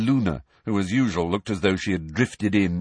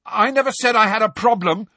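high, loud heated retort; heatedly; 14 semitones higher, 6 dB louder than usual. A narrator's reading. Ron snapping back at Harry, loud and heated. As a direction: fire back, stung. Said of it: "said Ron heatedly".

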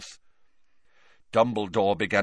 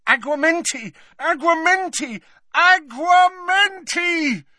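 high, building to a long loud cry; frantic spell cries; 5 dB louder than usual. A narrator's reading. Harry as Dumbledore's breathing fades, frantic incantation. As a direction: chant it desperately, climbing to a shout.

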